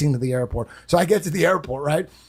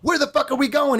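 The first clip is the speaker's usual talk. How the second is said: high, alarmed demand; panicked question; 10 semitones higher than usual. Retold or imitated. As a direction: blurt it out in confused panic. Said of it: "I go".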